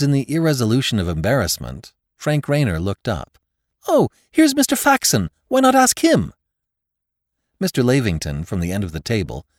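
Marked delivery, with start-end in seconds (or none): 3.9-6.3 put-on young man voice. Young nephew speaking up eagerly.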